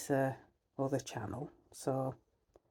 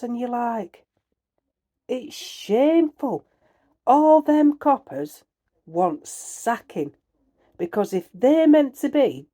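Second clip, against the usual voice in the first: much higher and louder, outraged; furious rant; 10 semitones higher, 9 dB louder than usual. Real, not acted. Vlogger raging at police to viewers, furious shout. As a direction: let the outrage pour out.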